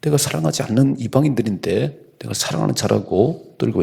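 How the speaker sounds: usual speaking voice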